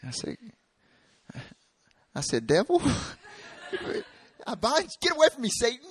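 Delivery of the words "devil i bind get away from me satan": high, loud hammed-up exorcism cry; squealed, over-the-top rebuke; mock rebuke. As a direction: cast out the devil in comic panic. Acting his rebuke of the devil.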